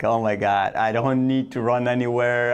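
usual speaking voice

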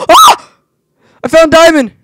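very high, loud squeal; high-pitched squeal. Real, not acted. Gamer finding diamond, squealing very high and loud. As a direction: squeal the find. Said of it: "That was a really high-pitched voice".

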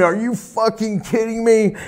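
high-pitched outraged mock scream; retold scream. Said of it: "he came out screaming".

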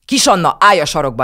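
put-on grandma ordering the child away; angry order. A narrator's reading. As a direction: bark at the child. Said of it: "rángatta fel Kisannát".

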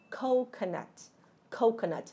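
ordinary talk

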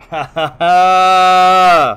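loud laugh breaking into the word; frustrated laugh. Real, not acted. Streamer stuck unable to read comments, laughing in frustration. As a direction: laugh in disbelief mid-sentence.

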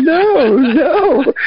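very high, laughing wail of no; laughing, high-pitched mock despair. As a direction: ham up the childhood grief. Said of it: "crying like a mother".

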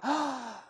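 high, short theatrical gasp; overwhelmed gasp. Acted. Survivor of the car crash, overwhelmed gasp. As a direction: gasp as if overcome with relief.

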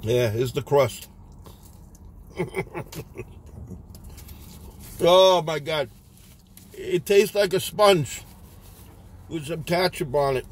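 eating pizza while talking; chewing with mouth full. Real, not acted. Seen 3: bites crust at 1.0–2.3, then visibly chews through later frames.

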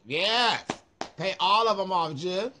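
loud happy cheer; excited. Real, not acted. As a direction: celebrate paying it all off. Cheering paying off credit cards.

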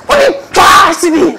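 sudden outraged shout. Acted. Pastor yelling at the brother. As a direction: yell at him in mock outrage.

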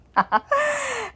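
a short, high burst of real laughter; amused laugh. Real, not acted. Laughing at daughter pushing shark.

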